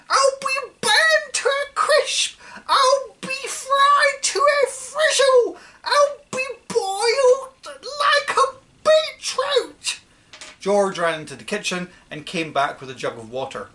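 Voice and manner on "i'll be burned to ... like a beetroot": very high, loud, slow wailing screech; wailing old woman. Acted. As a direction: moan each doom as if burning alive. Grandma wails while on fire.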